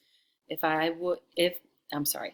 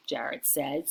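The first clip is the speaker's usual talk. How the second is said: plain reading voice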